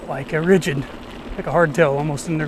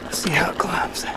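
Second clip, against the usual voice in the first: whispering; 5 dB quieter than usual. Real, not acted. Quietly bracing for the climb.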